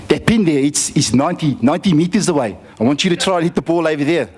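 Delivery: imitated South African coach giving instructions; South African accent. Coach sets boy a target, mock South African accent. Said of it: "I've never heard this accent before".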